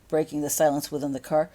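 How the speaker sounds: plain reading voice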